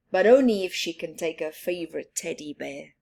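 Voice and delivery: ordinary delivery, nothing marked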